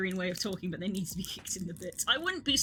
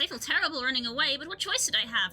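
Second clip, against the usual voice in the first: higher, fretful voice of a guilty coward; cowardly survivor; 6 semitones higher, 3 dB louder than usual. Acted. Streamer voicing the quest giver who fled his friends, higher and fretful. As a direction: whine it like someone excusing his own escape. Reads a fleeing NPC's quest text.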